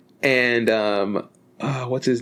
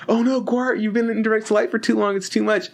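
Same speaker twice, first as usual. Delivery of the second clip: higher, lightly mock-worried; fussy worried voice; 8 semitones higher than usual. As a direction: fuss over her in a raised pitch. Voicing the coder fussing over Gwart.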